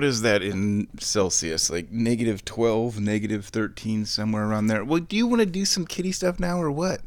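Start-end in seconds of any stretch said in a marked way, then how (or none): 4.8-7.0 high coaxing pet voice; sing-song call to the cat; talking to the cat. Real, not acted.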